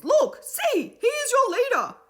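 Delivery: high, loud eager shout; excited shout. A narrator's reading. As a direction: point and cheer him on. Friends cheering for Will.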